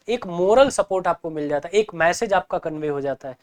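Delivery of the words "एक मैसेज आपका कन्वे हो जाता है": ordinary talk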